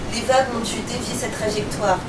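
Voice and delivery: ordinary delivery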